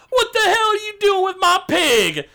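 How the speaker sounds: high, hollered orc voice; outraged orc. Acted. Orc from the bar confronts thieves, outraged yell. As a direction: holler it at a pig thief. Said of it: "an orc steps out".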